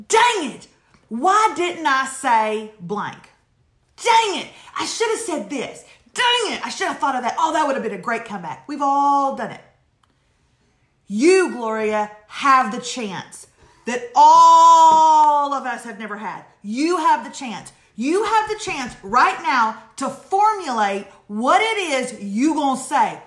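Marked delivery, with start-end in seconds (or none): 0.1-8.7 hammed-up frustration over a missed comeback; frustrated self-scolding. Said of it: "hours later said". 11.1-23.2 slow, loud, drawn-out sermon build; rally her like a preacher; preachy pep talk. Acted.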